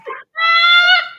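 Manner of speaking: loud, high burst of laughter. Real, not acted. Host cracks up at the husband's advice, loud laugh. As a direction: let a big high laugh explode out.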